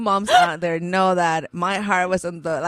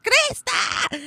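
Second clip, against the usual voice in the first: high-pitched mock scream of a name; frantic high call, acted out; retold scream. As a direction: call out in a panicked high squeal, not at full volume. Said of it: "I'm growing louder, like, I'm screaming at Target".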